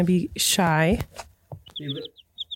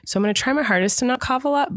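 ordinary delivery

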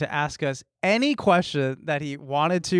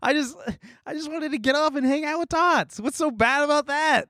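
whiny protest; 9 semitones higher than usual. Acted. Whiny self-defense.

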